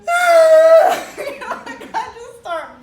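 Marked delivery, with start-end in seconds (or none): 0.1-1.1 a sudden piercing half-laugh, half-sob yelp; overwhelmed laughing cry. Retold or imitated. After her speech to the panel, overwhelmed laughing cry. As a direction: let it burst out of you, high and loud.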